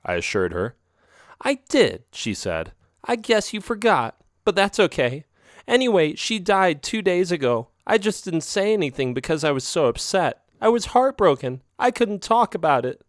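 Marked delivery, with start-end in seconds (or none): 1.4-2.0 put-on higher, coaxing young female cat voice; sly girlfriend cat. A narrator's reading. Narrator voices Isabel. 3.0-13.0 put-on high, upset girlfriend cat near tears; crying. A narrator's reading. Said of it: "She cried again".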